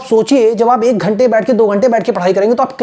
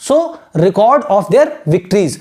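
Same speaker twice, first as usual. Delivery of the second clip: very slow, word by word; teacher dictating; slow dictation; much slower than usual. Shown as a demonstration. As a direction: dictate slowly for writing. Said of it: "आप लिख सकते हो कि".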